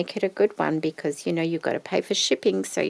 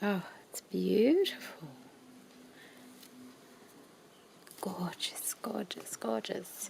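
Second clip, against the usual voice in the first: whisper=40; 9 dB quieter than usual. Real, not acted. Whispering in awe.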